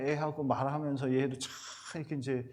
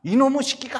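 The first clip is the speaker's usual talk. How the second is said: put-on a furious, much higher, much louder yell at the kid; angry parent yell; 11 semitones higher, 9 dB louder than usual. Parent yelling at a failing child, furious shout. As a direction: blow up at the child, screaming.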